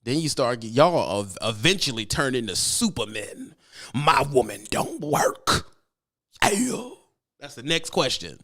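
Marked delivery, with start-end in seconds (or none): 3.9-6.9 slow, hammed-up proud husband; lightly voiced bragging trucker; mock macho boast.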